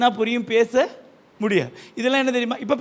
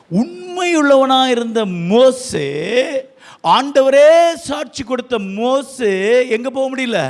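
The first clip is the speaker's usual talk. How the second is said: higher, louder, slow preacher's cry; fervent preaching; 3 semitones higher, 4 dB louder, slower than usual. Acted. Pastor urging the congregation, fervent loud preaching. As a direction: drive the question home to the church.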